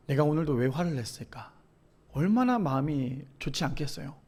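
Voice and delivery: soft, low self-reproach; hushed, remorseful musing; quiet regret. Retold or imitated. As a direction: reflect softly, regretting the outburst. Regret after scolding his son.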